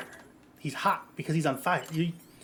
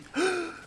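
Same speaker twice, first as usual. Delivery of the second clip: mock gasp; 4 dB quieter than usual. Mock offer of a kiss.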